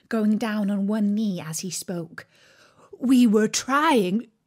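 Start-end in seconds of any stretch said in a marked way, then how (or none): 3.0-4.3 humble gardener. A narrator's reading. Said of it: "in a very humble tone".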